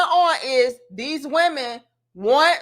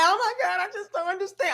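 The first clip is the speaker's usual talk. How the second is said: mocking, higher whine; mock crying whine; 6 semitones higher, 5 dB quieter, faster than usual. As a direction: whine like a lost woman. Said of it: "So don't come on here crying like".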